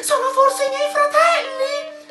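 very high, thin questioning voice; high-pitched feminine character voice; hopeful wife. A narrator's reading. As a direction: ask it eagerly in a sky-high voice.

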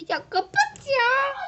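high mock-crying plea; whimpering plea. Real, not acted. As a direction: whine it like near tears. Begging the ad to close.

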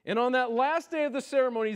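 ordinary talk

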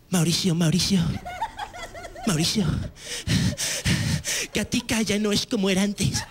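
mock Castilian Spanish accent, laughing; hammed-up Spain Spanish drama actor; a touch of Spanish accent. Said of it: "con un acento español".

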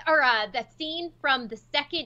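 usual speaking voice

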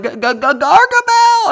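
high, loud stammered cry of alarm; stuttering panic. Retold or imitated. Clumsy spotting the villain, loud high stammering panic. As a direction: stammer the name out in mock terror. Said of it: "And then he's like".

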